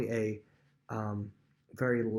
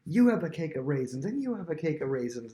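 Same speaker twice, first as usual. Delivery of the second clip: higher-pitched, imitated jubilation; celebratory; 5 semitones higher than usual. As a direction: hand out gifts like an elated king, voice lifted. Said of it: "he's in that celebratory mode".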